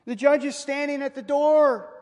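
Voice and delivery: high, loud and ominous, falling hard on door; booming warning. Acted. Preacher warns the congregation of judgement, booming and ominous. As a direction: thunder it from the pulpit like a verdict.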